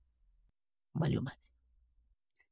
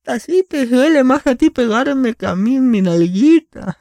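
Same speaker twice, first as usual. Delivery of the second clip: mock kid voice; 9 semitones higher, 5 dB louder, slower than usual. Mocking mom's spanking line.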